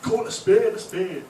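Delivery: high, loud, drawn-out Yorkshire voice; hammed-up slow Yorkshire proclamation; mock Yorkshire saying. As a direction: declare it like a caricature Yorkshireman. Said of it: "they have this phrase which they say".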